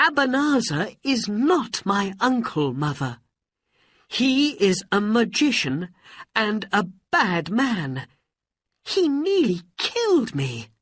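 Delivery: high, emphatic accusation; indignant. Acted. Aladdin telling mother about the bad magician, indignant. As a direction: pour out the outrage in a high voice.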